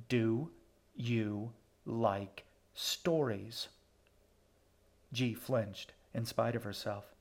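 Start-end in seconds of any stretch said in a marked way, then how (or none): 0.1-3.7 very slow, word-by-word repeated question; sour, disappointed. A narrator's reading. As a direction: space out "Do ... you ... like ... stories" with sour patience. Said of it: "sour and disappointed".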